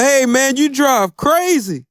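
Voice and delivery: mock yell. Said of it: "The other cleaners yell".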